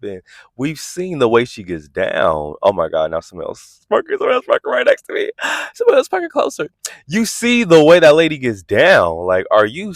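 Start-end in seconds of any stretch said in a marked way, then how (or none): 3.9-6.7 startled gasp. Real, not acted.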